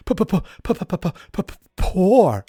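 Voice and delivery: long popping stammer on P, then a loud "poor"; exaggerated stutter. Retelling the guess he might be poor, hammed-up stutter. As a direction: sputter repeatedly before finally blurting the word. Comic stutter he says he added.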